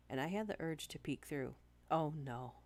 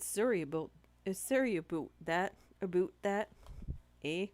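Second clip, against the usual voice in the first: hammed-up Canadian accent, louder, drawing out the sorry; mock Canadian accent; 2 semitones higher, 6 dB louder than usual. Streamer teasing American viewers in a put-on Canadian accent. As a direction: play up a Canadian accent for the chat, eh at the end. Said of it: "Canadians have an accent".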